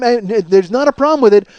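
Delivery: normal talking voice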